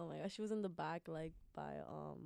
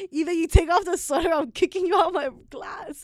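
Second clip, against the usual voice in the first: loud, higher mock ultimatum; threatening teacher; 5 semitones higher, 7 dB louder than usual. Teacher threatens to kick student out, loud ultimatum.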